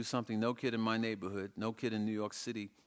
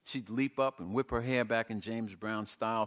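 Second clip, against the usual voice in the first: ordinary delivery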